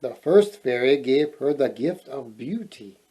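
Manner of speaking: ordinary delivery, nothing marked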